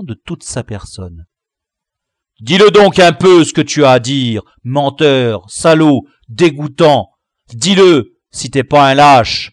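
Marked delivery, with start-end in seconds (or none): 2.4-9.5 loud, furious insults in his face; roaring challenge. Acted. The chief roars at the liar, loud and furious. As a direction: dare him to speak. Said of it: "il lui rugit à la face".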